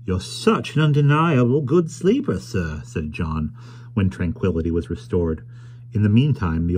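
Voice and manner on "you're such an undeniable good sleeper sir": carrier John's voice. Acted. John teasing the sleeping stranger.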